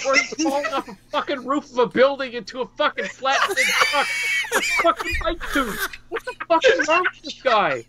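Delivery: high, laughing, incredulous rant; worked-up rant. Real, not acted. Cohost ranting about a stunt wrestler he hates, worked up.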